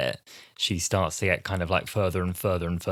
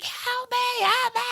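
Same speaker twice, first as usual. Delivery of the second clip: very high, thin mock cry for help; mock desperate cry; slower than usual. Retelling a drowning girl clinging to the pier, high mock wail. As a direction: squeal it like someone slipping off a pier.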